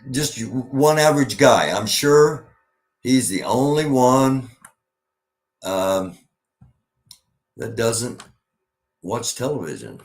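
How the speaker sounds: loud, high mock disbelief; sarcastic. Real, not acted. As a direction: lay the sarcasm on thick. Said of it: "I'm being sarcastic".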